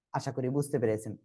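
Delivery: normal talking voice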